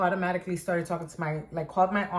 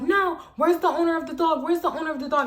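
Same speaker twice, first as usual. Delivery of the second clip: demanding lady; 7 semitones higher than usual. Retold or imitated. Neighbor lady demanding the owner, high insistent. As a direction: insist relentlessly, entitled.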